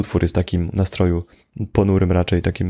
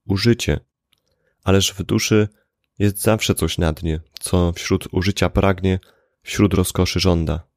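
poem recitation. Acted. A student reading the poem aloud to the class in a solemn recital. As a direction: recite each line like a grave question.

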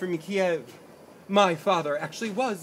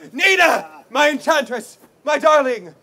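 adoring; 7 semitones higher, 8 dB louder than usual. Acted. Lover calling to Nina.

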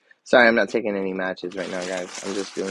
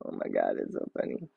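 ordinary talk

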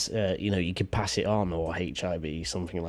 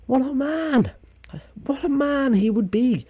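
very high put-on voice, gushing; hammed-up admiration. Vlogger imagining [name] alive, high mock gushing. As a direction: push it way up into a squeal of praise.

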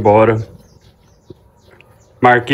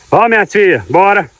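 higher, coaxing herding call; calling a cow; 8 semitones higher than usual. Real, not acted. Farmer calling a cow to come, higher.

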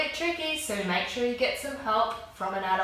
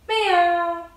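put-on high, louder meow for the kids; loud cat meow; 8 semitones higher, 7 dB louder than usual. Shown as a demonstration. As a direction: belt out a playful cat meow. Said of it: "make the cat noise".

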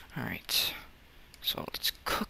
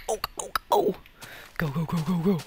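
high, urgent go go go; frantic chant of go; excited urging; 5 semitones higher, faster than usual. Real, not acted. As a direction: hurry yourself along, pitched way up. Running in game.

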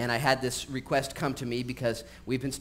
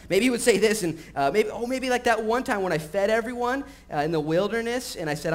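higher, louder sarcastic musing; sarcastic mock Jesus; 10 semitones higher, 6 dB louder than usual. Preacher voicing Jesus mocking the crowd, sarcastic. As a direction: sarcastically wonder aloud which plain answer they mean. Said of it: "even more sarcastic".

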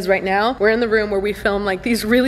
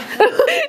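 shrieking laugh; 4 dB louder than usual. Real, not acted. Friend frightened, shrieking laugh.

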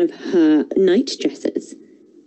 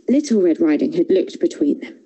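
ordinary delivery

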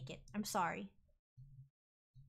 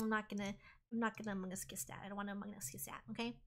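low, very soft refusal; reluctant mumble; 9 semitones lower, 19 dB quieter, much faster than usual. Retold or imitated. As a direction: mumble it under the breath, mock reluctant. Reluctant character refusing the kiss.

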